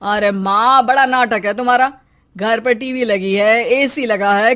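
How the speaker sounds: ordinary talk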